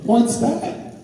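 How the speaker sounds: mock-puzzled, talking down, slow on the first words; a lightly voiced condescending young girlfriend. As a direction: play dumb and superior at once. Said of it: "She was so condescending".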